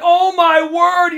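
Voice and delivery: hammed-up alarm at unexpected visitors; mock panic. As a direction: gasp it out like unexpected guests just called. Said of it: "You're like".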